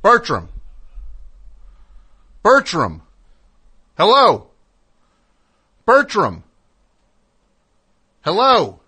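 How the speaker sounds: loud worried calls into silence; calling out. Pretend. Calling out to vanished friend.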